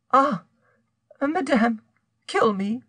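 high, pleading woman's voice; despairing. A narrator's reading. Unhappy woman begs madame to kill her, despairing. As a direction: beg for death in high-pitched misery.

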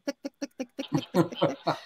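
soft high ticking steps, breaking into a laugh; light clicking patter; tiny footsteps. Retold or imitated. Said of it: "un niño chiquito así en dos de tec".

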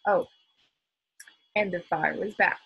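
ordinary delivery, nothing marked

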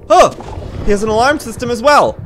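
loud, high startled cries; alarmed shouts; panicked alarm. Real, not acted. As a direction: yelp at the alarm. Another alarm.

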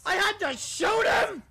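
very high, loud protest; outraged shout. Real, not acted. Player outraged at the game, high shout. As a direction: yell it in disbelief. Forced to shoot character.

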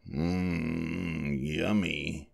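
very soft, sleepy savouring; dreamy mumble. A narrator's reading. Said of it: "he mumbled".

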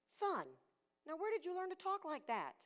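a dad's pitch jumps high in surprise; a shocked father asking his son. Retold or imitated. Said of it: "So I said".

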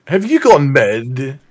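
loud, drawn-out bad French accent. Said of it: "with a French accent".

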